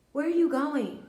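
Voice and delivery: loud, high demand from a nosy witch. Retold or imitated. Witch stops a neighbor from her apartment complex, mock nosy demand. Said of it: "and she was like".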